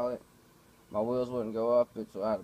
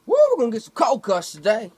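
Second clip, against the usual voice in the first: much higher and much louder, cheering; mock ad-voice cheer from a cold cuts commercial; hyped commercial cheer; 10 semitones higher, 13 dB louder than usual. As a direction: shout it like an overexcited TV ad. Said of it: "it reminded me of that commercial".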